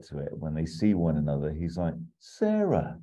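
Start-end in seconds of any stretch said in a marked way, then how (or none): 2.2-3.0 put-on a much higher, soft-boy Sarah. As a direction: croon the name like a soft boy. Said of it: "in a proper Brett Soft boy voice".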